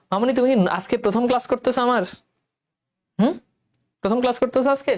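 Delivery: usual speaking voice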